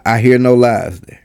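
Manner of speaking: usual speaking voice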